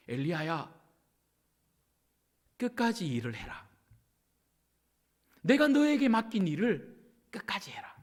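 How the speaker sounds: hushed, low, with long pauses; God's calm charge. Acted. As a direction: entrust the task gently, leaving space between phrases. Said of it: "하나님이 말씀하신 거는요".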